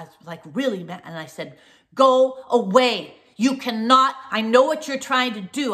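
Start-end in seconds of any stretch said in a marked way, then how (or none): 1.9-3.1 slow, very loud and high, dragged out; really loud. Retold or imitated. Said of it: "I said really, really loud". 3.4-5.7 loud, high accusing shout; really loud. Retold or imitated. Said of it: "I said really, really loud".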